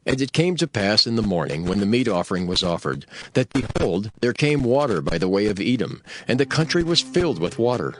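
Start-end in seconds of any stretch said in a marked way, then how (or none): none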